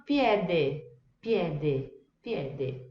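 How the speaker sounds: piede with open e and final eh; Italian piede. Shown as a demonstration. Teacher models piede. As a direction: say piede as pyeh-deh. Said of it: "um outro exemplo".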